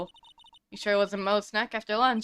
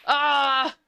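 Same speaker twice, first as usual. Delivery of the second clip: scared scream; 4 semitones higher, 5 dB louder than usual. Acted. Scared by the tiger.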